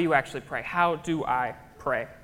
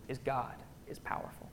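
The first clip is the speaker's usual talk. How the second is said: hushed whisper; 3 semitones lower, 13 dB quieter than usual. Real, not acted. Hushed awe in sermon.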